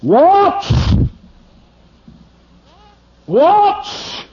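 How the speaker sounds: high loud bark; shouted command. Real, not acted. Preacher commanding his listeners to watch, shouting.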